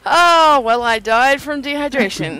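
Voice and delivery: loud exasperation. Real, not acted. Reacting to dying.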